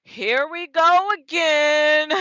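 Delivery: slow, drawn out, exasperated; weary sigh. Retold or imitated. To herself at the blocked dock, slow exasperated groan. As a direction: stretch it out, fed up already. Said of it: "I'm like".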